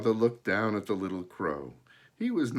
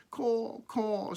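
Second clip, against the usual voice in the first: high cawing crow; bright high crow squawk; 13 semitones higher than usual. A narrator's reading. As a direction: caw like a little crow. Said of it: "said the little crow".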